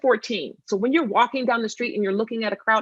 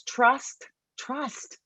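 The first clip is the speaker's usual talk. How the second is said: "trust" drawn out high, then repeated softer; stress on trust; 5 semitones higher, 4 dB quieter than usual. Real, not acted. Presenter underlining that restorative process builds trust, emphasized slowly. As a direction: let "trust" hang slowly, then echo it quietly. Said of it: "I emphasize that word too".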